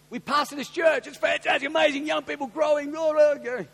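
high, loud gushing enthusiasm; excited pitch. Acted. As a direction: rattle off a rave at high pitch. Repeats his excited church pitch.

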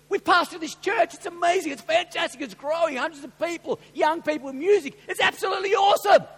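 high, loud gushing enthusiasm; excited pitch. Acted. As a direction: rave about it at a shout, building to the last word. Acts out his over-excited church pitch.

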